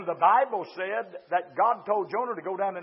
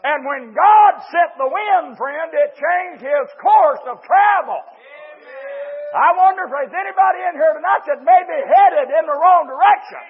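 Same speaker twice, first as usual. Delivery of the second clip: high, loud, driving sermon delivery; full-force preaching; 8 semitones higher, 14 dB louder than usual. Real, not acted. Preacher warns those headed in the wrong direction, full-force preaching. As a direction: preach it hard to the congregation.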